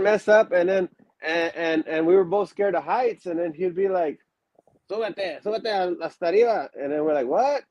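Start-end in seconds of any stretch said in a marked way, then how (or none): 4.9-6.7 lightly raised trainer voice barking in Spanish; a hint of the gruff Mexican trainer ordering them up; Super Mojado impression. Wrestling trainer ordering scared students up the ropes, lightly voiced. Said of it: "that's my, uh, impersonation of, uh, uh, Super Mojado". 7.3-7.6 startled what. Retold or imitated. Scared students answer the trainer.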